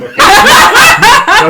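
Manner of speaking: very loud, high laugh; shrieking laugh; burst of laughter. Real, not acted. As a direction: crack up helplessly. Cracks up at own accent.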